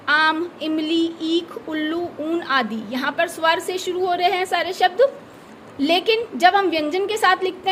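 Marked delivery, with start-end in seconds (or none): 0.1-2.1 slow, careful reading of आम, इमली; each word spelled out slowly; careful classroom reading; example words read out. Shown as a demonstration. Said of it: "जैसे अब".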